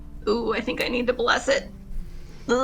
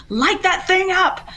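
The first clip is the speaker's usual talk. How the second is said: yelling; 10 semitones higher, 4 dB louder, slower than usual. Acted. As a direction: call it out loud and high. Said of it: "I'm gonna yell at Jules".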